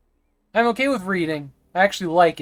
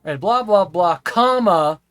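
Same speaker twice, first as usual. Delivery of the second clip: silly reading; 2 semitones higher, 6 dB louder, slower than usual. Shown as a demonstration. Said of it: "I'll just start doing that".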